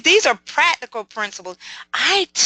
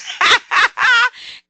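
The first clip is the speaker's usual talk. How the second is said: loud laugh; 10 semitones higher, 5 dB louder than usual. Real, not acted. Laughs at Jennifer's lost money.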